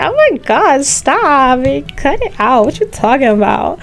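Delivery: hammed-up American movie voice, starting high and easing down; American movie accent. Teased for sounding like American movies, hammed-up American accent. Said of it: "you sound like the American movies".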